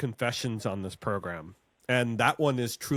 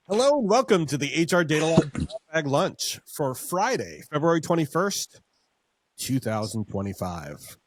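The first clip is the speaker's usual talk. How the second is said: show host intro; 5 semitones higher, 5 dB louder than usual. Acted.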